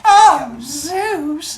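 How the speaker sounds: high, loud, drawn-out swoon; hammed-up smitten girl; mock swoon. Leto swoons at Zeus.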